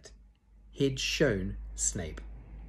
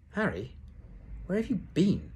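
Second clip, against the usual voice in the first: much higher, squeaky question; squeaked; 10 semitones higher than usual. A narrator's reading. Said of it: "Hermione squeaked".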